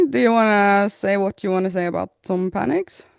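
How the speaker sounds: ordinary talk, nothing marked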